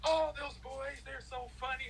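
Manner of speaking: high-pitched doting mother, mimicked; imitated teammates' mom. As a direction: play a mom brushing off her boys' antics. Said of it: "She's like".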